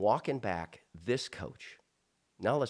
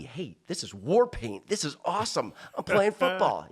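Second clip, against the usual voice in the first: jumps higher and louder on the last words, thrilled; retold boyish excitement; 8 semitones higher, 6 dB louder than usual. Eleven-year-old at practice, thrilled, higher and louder. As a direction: relive a kid's thrill at his first bloody nose.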